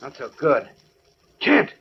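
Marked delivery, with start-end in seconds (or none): 1.4-1.7 high urgent call. Acted. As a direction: call his name to wake him. Calling to unconscious Kent.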